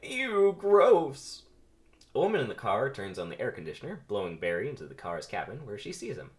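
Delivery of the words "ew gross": high, loud grossed-out cry; loud disgusted bee. Acted. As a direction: recoil in disgust. Barry sees dead bug.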